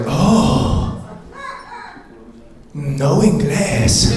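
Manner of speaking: put-on a light Mexican accent, slow on no inglés; mock Mexican accent. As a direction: play the doctor with a hint of Mexican accent. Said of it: "the doctor's like".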